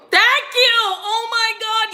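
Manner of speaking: high, loud cry of thanks; overwhelmed gratitude. Real, not acted. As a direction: burst out high, laughing and near tears. Overwhelmed thanks during the reading.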